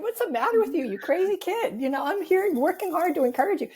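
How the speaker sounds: high-pitched incredulous inner rant; exasperated. Retold or imitated. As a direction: act out baffled parental exasperation.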